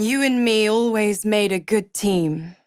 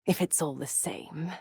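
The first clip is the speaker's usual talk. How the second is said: much softer, whispery and lower-key; hushed aside; 3 semitones lower, 12 dB quieter than usual. Acted. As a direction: drop it to a near whisper. Villain murmurs an aside.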